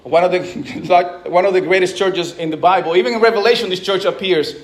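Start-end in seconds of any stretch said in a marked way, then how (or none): none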